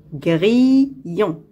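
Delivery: "Grillon" drawn out and split gri, llon; syllable by syllable. Shown as a demonstration. Teacher locating a sound for pupils, "Grillon" in two separated syllables. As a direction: pause between the syllables of "Grillon". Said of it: "Dans la deuxième syllabe".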